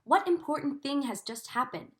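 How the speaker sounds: ordinary delivery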